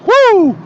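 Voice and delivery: high, loud fired-up whoop; amped whoop. Real, not acted. Vlogger hyping himself up mid-rant, loud high whoop. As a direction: let out a pumped-up yell. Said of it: "I'm getting amped, I'm getting loud".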